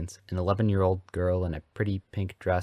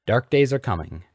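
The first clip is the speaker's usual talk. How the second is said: lifted, louder ominous warning; grave pronouncement; 5 semitones higher, 6 dB louder than usual. A narrator's reading. Dinner guest warns the adults of dark times, gravely. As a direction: pronounce doom with weight. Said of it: "Hagrid pronounced gravely around a mouthful".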